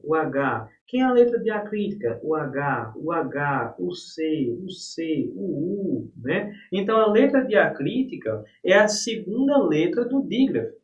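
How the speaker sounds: normal talking voice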